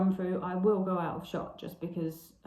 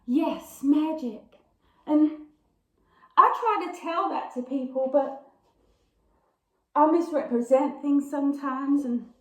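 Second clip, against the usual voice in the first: high, theatrical monologue with long pauses; impassioned stage heroine, raised pitch, drawn-out words; Blanche; 7 semitones higher, 5 dB louder than usual. Acted. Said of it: "I will be Blanche".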